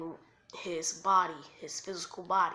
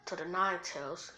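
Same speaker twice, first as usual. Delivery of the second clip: usual speaking voice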